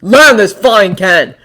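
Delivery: loud slow snapped order. A narrator's reading. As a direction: snap it into the mic. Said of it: "He snapped into a mic".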